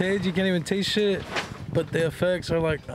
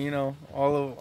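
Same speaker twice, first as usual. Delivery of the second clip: ordinary talk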